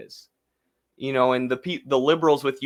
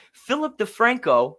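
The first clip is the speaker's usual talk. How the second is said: ordinary talk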